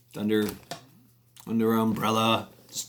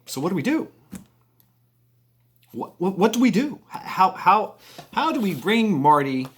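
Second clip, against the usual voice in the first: higher, mildly urgent appeal; retold pleading question; 8 semitones higher, 4 dB louder than usual. [name] asking the FBI agent for a way forward, pleading. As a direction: act at a loss, pitch climbing.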